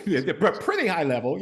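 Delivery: amused laugh through the words. Real, not acted. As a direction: chuckle through the phrase. Laughing at Biden's "high level job.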